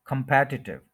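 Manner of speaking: Competitive as kum-PET-i-tiv; competitive with strong second syllable PET; stress on second syllable. Shown as a demonstration. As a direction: hit PET in competitive, keep the rest short. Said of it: "second के ऊपर stress है".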